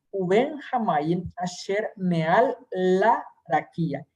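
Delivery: slow reading, "la" held with a small pause before "rakia"; pausa en "la". Shown as a demonstration. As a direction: brake gently on "la", then say "rakia". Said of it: "tengo otra pequeña pausa en la lamed". (lectura lenta con pequeña pausa en "la")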